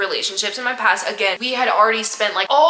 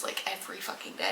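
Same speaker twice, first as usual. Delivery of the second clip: whispered; 16 dB quieter than usual. Real, not acted. Aside under her breath.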